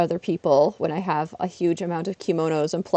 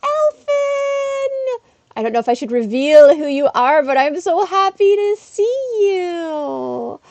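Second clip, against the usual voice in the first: high, loud delighted welcome; excited greeting; 10 semitones higher, 6 dB louder, slower than usual. Real, not acted. Streamer greeting a returning viewer, delighted.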